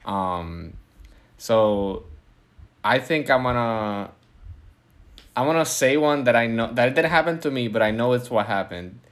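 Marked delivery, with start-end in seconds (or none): none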